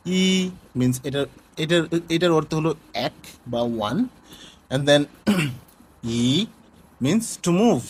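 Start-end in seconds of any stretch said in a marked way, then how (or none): 0.1-0.5 ই held high and level; first tone. Shown as a demonstration. Teacher showing first tone on one word, high level ই. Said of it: "আমরা যখন first টোনে". 6.1-6.4 ই bending upward; second tone. Shown as a demonstration. Teacher showing second tone meanings, rising ই. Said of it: "উপরের দিকে একটু বাঁকা হয়ে যায়".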